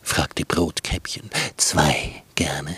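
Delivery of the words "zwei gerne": low, whispered wolf; sly wolf. Acted. Wolf voice answering.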